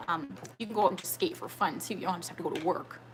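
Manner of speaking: usual speaking voice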